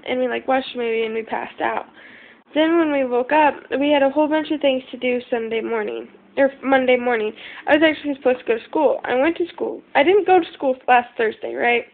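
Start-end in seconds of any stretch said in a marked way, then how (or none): none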